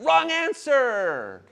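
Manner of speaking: slow, drawn-out buzzer call; playful drawn-out rejection; mock game-show wrong answer. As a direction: reject it like a quiz host.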